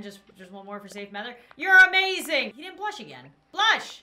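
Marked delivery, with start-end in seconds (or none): 1.6-2.5 loud mock gushing. Praising the villager to make him blush, loud. 3.5-4.0 high, loud demand; playful command. Pretend. Ordering the villager to blush, high and loud.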